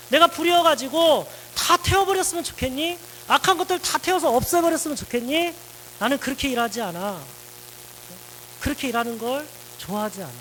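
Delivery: a father's rising rhetorical challenge, easing at the end; God speaking to Elijah. Acted. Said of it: "이렇게 얘기하고 있는 거죠".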